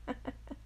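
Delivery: brief, very quiet giggle; small private chuckle; soft chuckle. Real, not acted. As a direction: let out a tiny hushed laugh. Small laugh after whispered yes.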